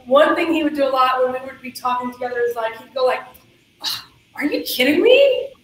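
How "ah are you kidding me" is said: lightly voiced exasperated boss; a hint of David rolling his eyes, higher; impression of David. Colleague imitating David's exasperation, mildly mocking. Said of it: "my best shot at doing an impression".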